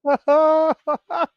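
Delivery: loud, high-pitched laughing fit; burst of laughter. Real, not acted. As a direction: crack up loudly. Host cracks up at the bit.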